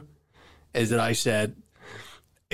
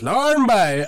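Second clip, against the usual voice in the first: higher, louder film-line imitation; called-out imitation; 8 semitones higher, 6 dB louder than usual. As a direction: call it out like a movie villain's summons. Quoting a film line, calling out.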